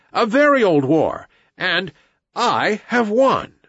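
put-on high, emphatic boy hero, teeth set; through clenched teeth. A narrator's reading. Mowgli tells Buldeo of his old war with the tiger, through clenched teeth. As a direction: speak up high, slowing on the last words. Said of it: "he said between his teeth".

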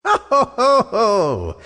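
high, booming giantess "ho ho ho"; gloating villainess laugh; terrible laugh. A narrator's reading. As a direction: laugh wickedly, starting high. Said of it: "she laughed terribly".

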